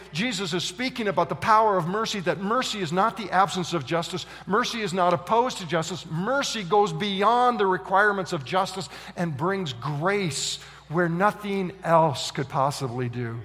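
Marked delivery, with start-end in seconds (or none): none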